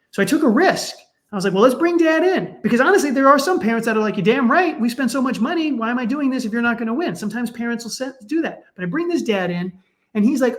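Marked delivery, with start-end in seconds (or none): 1.5-2.6 higher, louder, bright decisive suggestion; upbeat idea. Retold or imitated. Therapist quoting own bold idea. 4.2-7.1 lightly voiced indignant parent; pushy parent. Mocking pushy sports parents.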